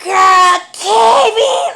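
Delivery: high, loud excited "Kevin"; excited shriek. Retold or imitated. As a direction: squeal the name like big news. Sister's excited reveal, retold.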